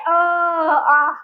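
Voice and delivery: "अ" short, then "आ" as one long separate vowel; vowel demo. Shown as a demonstration. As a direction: say "अ", pause, then a drawn-out "आ". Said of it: "ऐसे बोलते हैं".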